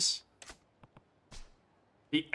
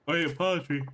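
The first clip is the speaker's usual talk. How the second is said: talking through a yawn; words stretched by a yawn; 3 semitones higher, faster than usual. Real, not acted. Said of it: "I'm yawning here".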